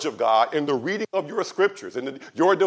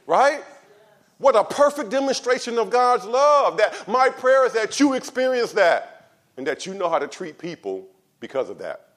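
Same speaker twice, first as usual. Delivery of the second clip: full-force preaching; 7 semitones higher, 6 dB louder than usual. Acted. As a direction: thunder it from the pulpit.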